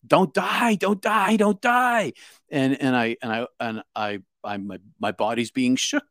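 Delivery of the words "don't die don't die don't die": high-pitched, strained mock scream; imitated scream. Friend pleads over an unresponsive body, imitated screaming. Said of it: "It's Timmy screaming".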